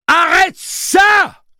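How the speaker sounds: very high, slow drawn-out loud yell; yell over alarm. Acted. Jake yells over the alarm: very high loud yell. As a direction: scream it over the siren. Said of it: "hurla Jake par-dessus l'alarme".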